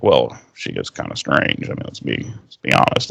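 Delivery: low hushed aside; confiding gossip. Retold or imitated. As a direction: lean in and half whisper. Willow gossiping about her friend.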